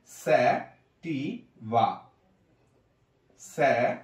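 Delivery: Sativa stretched very slowly; slow demo. Shown as a demonstration. Teacher modelling sativa for students, very slowly. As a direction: draw out sativa for the class. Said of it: "এরপরে sativa".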